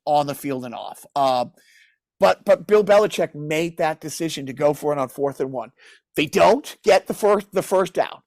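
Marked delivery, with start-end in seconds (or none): none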